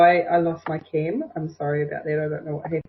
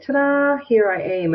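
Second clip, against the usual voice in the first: higher-pitched, bright sing-out of a ta-da; showy self-announcement; 6 semitones higher, 3 dB louder than usual. Acted. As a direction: announce yourself with a little fanfare. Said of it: "You just need to say".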